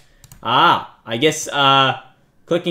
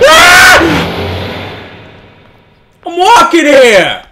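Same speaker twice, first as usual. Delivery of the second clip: very high scream, loud angry yell; hammed-up New York yell; startled scream then yell; 12 semitones higher, 8 dB louder, much slower than usual. Real, not acted. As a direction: yell at the car. Car almost hits him.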